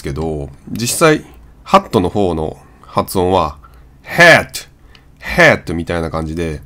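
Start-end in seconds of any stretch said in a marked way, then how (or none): 4.0-5.7 ヘッド as English hat, vowel between 'ah' and 'eh'; American hat, wide open 'æ'. Shown as a demonstration. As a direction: say ヘッド as a stretched 'haat' with the cat vowel. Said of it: "あとへの中間なんですよね".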